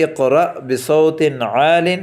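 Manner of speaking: اقرأ بصوت عال read slowly, word by word; slow model reading. Shown as a demonstration. Teacher models the phrase for students, slow and clear. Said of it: "ہم عربی میں جو جملے استعمال کرتے ہیں".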